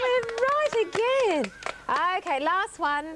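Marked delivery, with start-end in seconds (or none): 0.0-1.5 high, slow drawn-out joy; delighted. Real, not acted. Teacher celebrating a right answer with the children. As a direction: savour the win, sounding thrilled. Answer revealed correct again.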